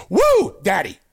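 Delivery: a light Ric Flair strut-call, drawn-out woo then daddy. Retold or imitated. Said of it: "You can't forget the daddy at the end".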